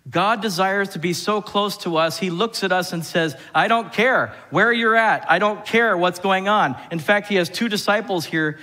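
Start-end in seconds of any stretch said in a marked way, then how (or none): 3.5-6.8 God speaking. Acted. Preacher voicing God to his congregation, loud and insistent. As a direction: proclaim it like God pleading.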